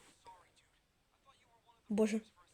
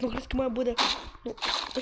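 ordinary delivery

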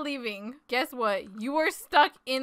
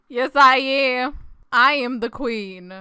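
usual speaking voice

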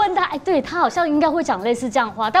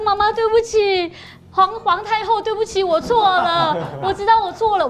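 pleading daughter; 7 semitones higher, 3 dB louder than usual. Retold or imitated. Mother retelling her daughter begging forgiveness, hammed-up. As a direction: plead like a sorry little girl. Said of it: "她说".

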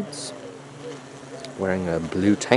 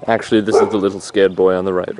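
usual speaking voice

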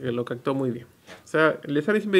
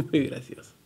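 normal talking voice

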